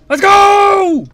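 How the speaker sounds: loud, high rallying yell; real gamer hype scream; hyped shout. Real, not acted. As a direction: fire yourself up, full voice. Gamer hyping himself up.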